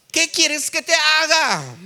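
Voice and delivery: high, loud, slow proclamation; preacher voicing Jesus at full voice; booming question. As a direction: call the question out, stretching it. Jesus's question acted in reading.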